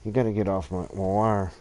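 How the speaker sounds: ordinary talk, nothing marked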